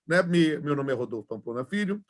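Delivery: usual speaking voice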